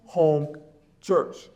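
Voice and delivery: ordinary talk, nothing marked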